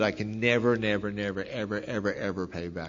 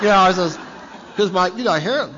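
laughing; 8 semitones higher, 9 dB louder than usual. Real, not acted. Laughing about burned hair.

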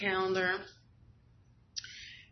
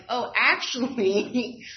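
dawning realization; 4 semitones higher, 6 dB louder, much slower than usual. Retold or imitated. Realizing her own absences.

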